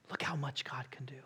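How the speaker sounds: sudden hushed whisper. Shown as a demonstration. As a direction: drop to an awed whisper. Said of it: "preacher contrasting man and God".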